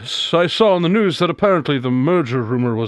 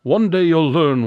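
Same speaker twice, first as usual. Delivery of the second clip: ordinary talk, nothing marked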